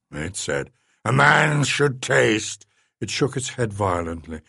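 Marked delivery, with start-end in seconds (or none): 1.0-2.7 slow creature voice. A narrator's reading. Beast quoting slowly.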